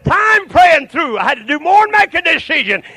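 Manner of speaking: revival-tent holler; full-force preaching. Real, not acted. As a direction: shout it out like a fired-up preacher.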